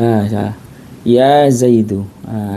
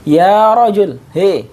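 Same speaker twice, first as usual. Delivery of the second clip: calling out; 5 semitones higher, slower than usual. Shown as a demonstration. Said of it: "kemudian kita manggil orang".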